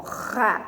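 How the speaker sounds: "ra" with a long guttural h sound; strong R. Shown as a demonstration. Teacher isolating the syllable from jarra, strong R. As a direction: say "ra" alone, rasping the R like a strong h. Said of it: "R forte entre duas vogais é duplo".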